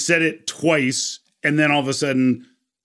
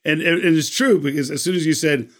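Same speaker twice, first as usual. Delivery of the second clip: ordinary delivery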